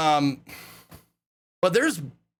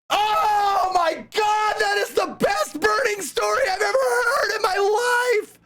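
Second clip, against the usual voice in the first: excited shout; 12 semitones higher than usual. Retold or imitated. Retelling his reaction to the Hoff story, ecstatic shout. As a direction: gush at the top of your voice.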